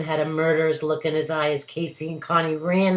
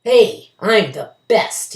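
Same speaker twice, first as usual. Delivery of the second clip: growled; 6 semitones higher, 9 dB louder, slower than usual. A narrator's reading. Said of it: "he growled".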